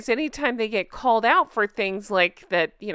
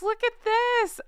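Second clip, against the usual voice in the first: excited; 14 semitones higher, slower than usual. Real, not acted. Showing off new merch.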